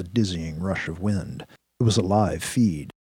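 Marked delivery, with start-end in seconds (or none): none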